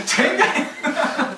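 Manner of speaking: very high, drawn-out plea through laughter; hammed-up wail dissolving into laughs; mock prayer, laughing. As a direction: squeal the plea while cracking up. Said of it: "이렇게 기도하겠죠".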